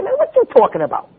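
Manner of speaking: high-pitched, casual street-style challenge. Shown as a demonstration. Preacher showing the natural way to object, casual and high-pitched. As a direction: pitch it way up and run the words together. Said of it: "We say".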